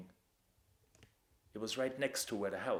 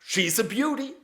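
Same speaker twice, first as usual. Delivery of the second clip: much higher, much louder German chant; slow, roaring mock summons to the coachman; yelling; 12 semitones higher, 12 dB louder than usual. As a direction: howl it at the dark like a drunk lout. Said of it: "Robert started yelling".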